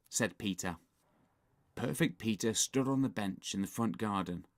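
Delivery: usual speaking voice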